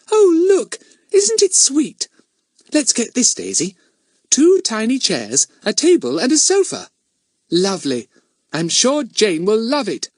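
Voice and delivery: put-on very high, delighted child voice; Betts, excited little girl. A narrator's reading. Little girl shows her friend dolls' furniture in a shop, excited. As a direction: squeal over a sweet find.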